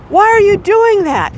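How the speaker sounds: high-pitched mock yell; retold daughter's yell. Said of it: "My daughter yells out".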